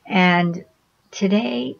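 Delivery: ordinary delivery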